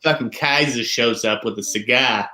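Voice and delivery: put-on gruffly worded Boston accent, R's dropped. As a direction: play a Boston movie tough in the local accent. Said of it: "what is that accent".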